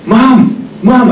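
high, loud frantic calls of Mom; panicked calling. Retold or imitated. Nurse panicking over her mother slumped in the chair. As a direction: call out in alarm, voice shooting up. Thinking he killed his mother.

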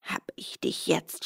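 soft, voiceless gloating whisper; triumphant whisper. A narrator's reading. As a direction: breathe it out as he grabs him. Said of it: "und schrie".

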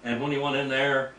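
normal talking voice

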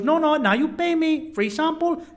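high, insistent hustler mimicry; Colombian street hustler. Hustler refusing tourist's protest, high imitated voice. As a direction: push back as the imitated tout. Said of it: "He goes".

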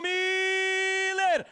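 stretched high shouting goal call; explosive goal commentary. Real, not acted. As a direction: roar the scorer's name, drawn out.

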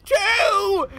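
high, drawn-out Cockney yell; heavy Cockney accent. Retold or imitated. Cockney mom counting bottles at her son, loud mock yell. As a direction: bellow it slowly as a Cockney mom. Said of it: "the heaviest Cockney accent I've ever heard".

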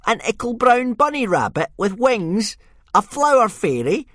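high, loud jeering bully voice; a sneering Viking boy taunting Hiccup; mocking bully. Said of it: "said Snotlout".

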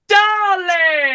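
high, loud theatrical diva greeting; gushing "Darling!". Retold or imitated. Star sweeping into the room, loud high diva greeting. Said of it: "she came in through the door and went".

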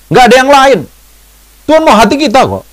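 high, loud and insistent; emphatic retort. Retold or imitated. Preacher insisting God wants our hearts, loud and emphatic.

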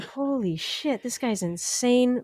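slow, stretched-out retold shock; stunned disbelief. Passenger recalling the reckless driver, in stunned disbelief.

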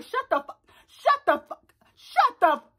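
high-pitched retold snapping, repeated in bursts; mock angry outburst. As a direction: act out an exasperated "shut up". Retelling Danny's angry outburst in quarrel.

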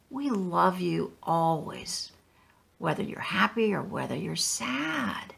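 put-on parents softly reassuring their sad child; tender reassurance.

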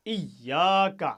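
ইয়াকা very slow and loud with the ইয়া doubled and pressed. Shown as a demonstration. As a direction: hit ইয়াকা hard and long. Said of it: "চাপ লাগিয়ে উচ্চারণ করতে হয়".

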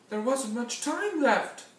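high, loud alarm; urgent narration. A narrator's reading. Narrator as the pocket watch is checked, urgent high voice. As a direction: raise the voice as time runs out. Time running out in story.

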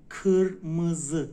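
کرمز slow and loud as KIR-MI-ZI, dull ı vowels; dotless ı sound. Shown as a demonstration. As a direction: stretch each syllable of kırmızı, loudly. («کرمز» بلند و هجا به هجا)

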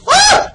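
very high, loud shriek; startled scream. Real, not acted. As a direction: scream as if suddenly ambushed. Sudden attack in game.

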